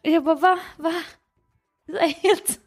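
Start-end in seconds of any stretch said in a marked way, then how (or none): 0.3-0.9 retold shocked questioning, slightly raised pitch. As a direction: act out being stunned. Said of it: "helt chockad". (återberättat chockad)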